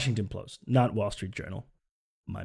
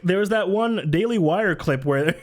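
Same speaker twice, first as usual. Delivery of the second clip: normal talking voice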